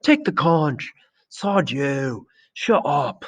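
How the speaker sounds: high-pitched heckling cries; storm of protest. A narrator's reading. Assembly rounding on Simon, angry high-pitched outcry. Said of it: "the storm broke".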